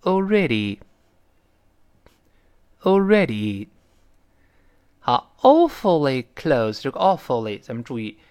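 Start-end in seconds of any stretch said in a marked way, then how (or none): 0.0-3.7 already very slowly, final sound a long ee; deliberate, stretched "already"; read-aloud model. Shown as a demonstration. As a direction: model "already" for learners to echo. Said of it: "跟老师再读一遍".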